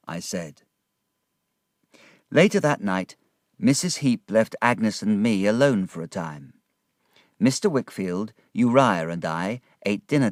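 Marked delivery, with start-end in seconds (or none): none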